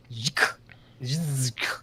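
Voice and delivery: Zhk buzzed like a bee, stopped by k. Shown as a demonstration. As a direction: draw out the zh in Zhk, no vowel. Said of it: "It's like a bee".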